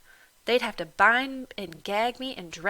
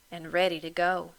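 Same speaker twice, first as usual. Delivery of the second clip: ordinary delivery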